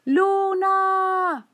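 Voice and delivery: high, long-drawn call. A narrator's reading. Girl calling for the missing moon, drawn-out cry. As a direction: call up to the sky, stretching the vowels. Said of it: "Zohar gritó".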